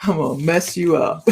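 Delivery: menacing tarot cards. Retold or imitated. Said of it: "tarot cards go".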